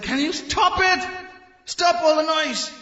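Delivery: irritated priests. Retold or imitated. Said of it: "and they said".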